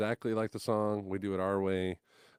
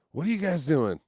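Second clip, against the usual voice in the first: put-on raised, louder questioning of kids; challenging question; 8 semitones higher, 8 dB louder than usual. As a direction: put them on the spot, loudly.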